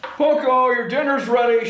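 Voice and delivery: loud, high call across the house; shouting. A narrator's reading. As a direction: call her in for dinner from afar. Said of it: "shouted her father".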